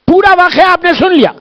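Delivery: high, loud impassioned preaching; a majlis orator at full pitch; full-force preaching. Acted. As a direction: drive it home to the congregation.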